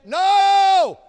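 high, loud shouted no; mock indignant no. The Southern crowd denies it, high shouted no. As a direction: fling back a shouted denial. Retold denial, mock protest.

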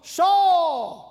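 loud, high call across the valley; David calling out to Saul from the stronghold, a loud high holler. Retold or imitated. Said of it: "he hollers".

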